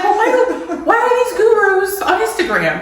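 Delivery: high, loud, laughing disbelief; laughing protest. Retold or imitated. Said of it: "And I'm like, I'm like".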